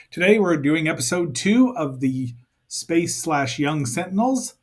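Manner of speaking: ordinary talk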